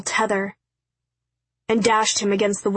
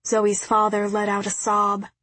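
ordinary delivery, nothing marked

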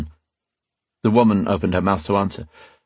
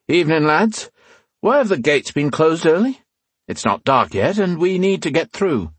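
friendly bluff; 9 semitones higher than usual. Acted. Rian addresses guards.

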